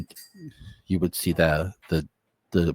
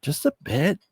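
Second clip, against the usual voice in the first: a much higher, youthful wondering voice; high teen voice; 14 semitones higher than usual. Acted. Teen wondering about the portrait in a high voice. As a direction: pitch up into a curious teenager.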